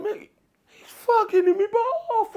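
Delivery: very high, squealed mock complaint; acted indignant squawk; high-pitched protest. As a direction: plead your case in a comic squeal. Acts out himself complaining to teammate.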